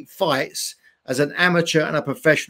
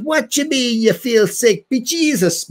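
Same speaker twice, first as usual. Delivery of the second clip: higher-pitched Irish dad, incredulous; Irish accent; 5 semitones higher than usual. Retold or imitated. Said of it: "This is my Irish accent".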